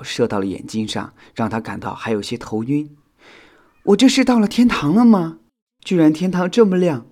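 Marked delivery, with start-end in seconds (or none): 3.8-7.1 high-pitched, loud wondering; dazed self-talk. A narrator's reading. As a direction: marvel aloud to yourself. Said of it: "火影自言自语道". (惊奇地自言自语)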